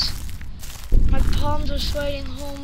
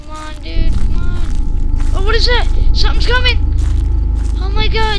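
startled panic; 3 semitones higher, 6 dB louder than usual. Real, not acted. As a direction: yell as something comes. Scary music, alarm.

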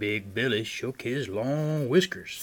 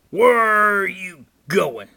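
loud, raised troll bellow; troll's yell; 5 semitones higher, 13 dB louder than usual. A narrator's reading. Troll demands to know of Great Big Billy, yelling. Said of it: "yelled the troll".

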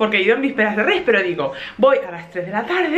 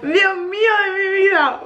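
high, drawn-out despair; exasperated; 9 semitones higher than usual. Retold or imitated. Mother despairs as the baby screams again. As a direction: sigh it out in disbelief. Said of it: "Y yo".

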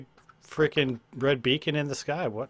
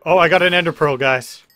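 excited; 3 semitones higher, 10 dB louder than usual. Real, not acted. Excited about finding item.

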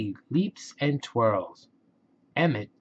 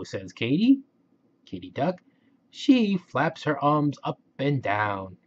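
usual speaking voice

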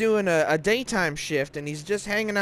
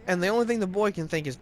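ordinary delivery

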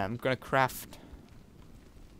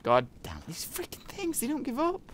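exasperated fear; 13 semitones higher than usual. Real, not acted. Chased again.

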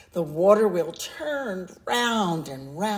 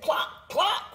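much higher voiced hen cluck; high chirpy cluck once more; 10 semitones higher than usual. A narrator's reading. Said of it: "Then she said".

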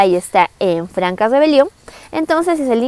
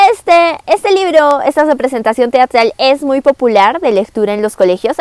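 ordinary delivery

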